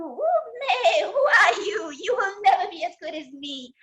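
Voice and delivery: high bleating shout with a baa; bleating sheep. Acted. The sheep shouts down the new puppy with a bleat.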